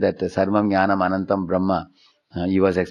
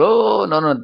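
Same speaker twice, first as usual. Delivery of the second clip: dismissive mock refusal; 12 semitones higher, 4 dB louder, slower than usual. Mocking Mayavadis rejecting Vaikuntha.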